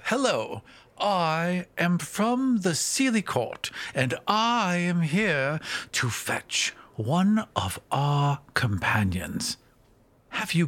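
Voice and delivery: high, slow and sweetly formal fairy; a tiny fae courtier, coolly polite; cold sweet ancient calm. Acted. Player voices fairy Cricket formally.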